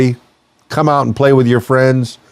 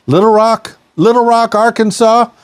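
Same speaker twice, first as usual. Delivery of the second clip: hype man call; 11 semitones higher than usual. Real, not acted. DJ hyping a new show city.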